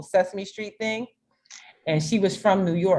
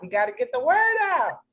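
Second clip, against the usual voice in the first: urgent rally; 10 semitones higher, faster than usual. Real, not acted. Rallying about the glitch.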